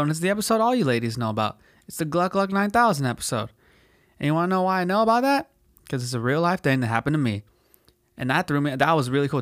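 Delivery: normal talking voice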